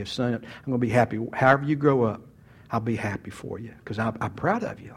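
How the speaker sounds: low, softening fatherly reassurance; lightly imitated dad, soft and low; gently voiced dad. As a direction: tell a son quietly that he is fine as he is. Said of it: "like that".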